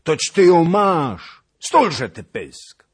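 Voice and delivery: high, very loud, slow rage; furious shout. A narrator's reading. Cossack furiously yelling at the witch to shut up. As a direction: roar it slowly, beside himself. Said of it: "zawołał z wściekłością kozak".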